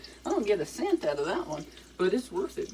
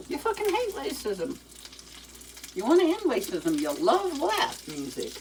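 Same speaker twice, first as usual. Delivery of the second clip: defensive alter ego; 5 semitones higher than usual. Pretend. Second persona talking back.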